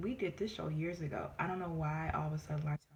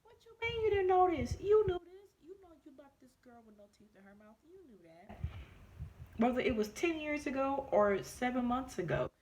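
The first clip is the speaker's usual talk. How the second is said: incredulous outburst; 9 semitones higher than usual. Retold or imitated. Confronting the doctor who denied knowing, high and accusing. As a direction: call her out, voice jumping high. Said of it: "I'm like".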